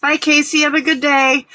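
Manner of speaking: high, loud sing-song farewell; cheery goodbye call. Real, not acted. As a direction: call it out brightly to a departing friend. Calling out a bright goodbye.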